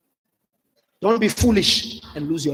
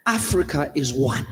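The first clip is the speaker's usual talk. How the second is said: usual speaking voice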